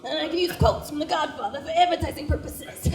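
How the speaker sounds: lightly voiced Long Island dad; a hint of a gruff Long Island dad. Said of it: "and he was like".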